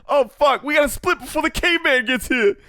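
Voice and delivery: high, far put-on panicked voice; Scout's voice. Scout urging everyone to flee the caveman, high panicked voice. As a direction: squeal it like a panicked friend. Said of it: "that's Scout".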